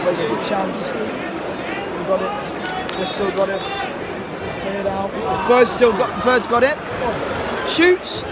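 rising, loud excited match call; live play-by-play. Real, not acted. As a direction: build the excitement as he shoots.

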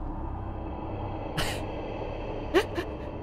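breathy high relieved laughter; nervous laugh. Real, not acted. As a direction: laugh off the fright. Laugh after the scare.

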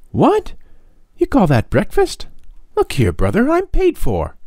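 put-on very high, outraged boyish complaint; indignant boy. A narrator's reading. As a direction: protest the tiny breakfast in mock outrage. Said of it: "Tom complaining about breakfast".

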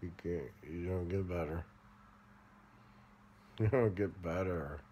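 ordinary talk, nothing marked

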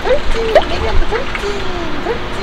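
high squeaky praise for a pet; squeaky praising a dog. Real, not acted.

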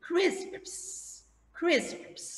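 crisps said high and soft, KRISPS; model pronunciation. Shown as a demonstration. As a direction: stretch out crisps so pupils hear each sound. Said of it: "คำเนี้ยออกเสียงก็ยาก". (ออกเสียง crisps ช้าๆ ชัดๆ)

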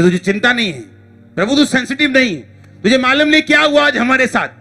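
high-pitched, insistent reproach in a run of short bursts; reproachful complaint. Acted. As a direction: complain urgently to someone who slept through the danger. Voices disciples complaining to sleeping Jesus.

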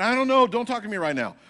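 high, loud flustered reply; dazed protest. Retold or imitated. Speaker just waking up, telling Clarence to stop, high and loud. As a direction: push him off, overwhelmed. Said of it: "I said".

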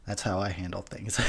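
soft whispered punchline; whispered aside. Real, not acted. Telling viewers how he handles things, whispered. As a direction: whisper it like a secret. Aside after ranting quote.

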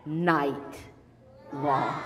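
loud, stretched storytelling words; drawn-out emphasis. A narrator's reading. Storyteller tells children how long the noise lasted, drawn out. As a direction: boom it out slowly, then drop.

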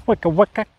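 a high, loud, playful wicka-wicka scratch noise; mock record scratch. Hiker caps his history joke with a loud high DJ scratch.

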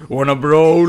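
loud catchphrase. Retold or imitated. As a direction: blurt it out loud. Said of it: "se ne esce fa ad alta voce".

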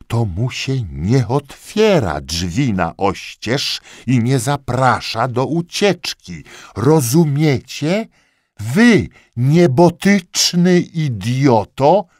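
from firm to very loud, high yelling; building rage. A narrator's reading. Lieutenant berating the corporal, rising to a furious yell.

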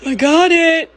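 loud, high cry of triumph; shouting I got it; triumphant. Real, not acted. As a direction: yell it out in triumph. Triumph after the struggle.

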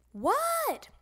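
put-on high, drawn-out kid's reply; kid calling back. Froggy answering his father, high kid holler.

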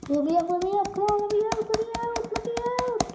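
high frantic begging; panicked pleading. Real, not acted. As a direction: beg to be let out, frantic. Trapped, begging to escape.

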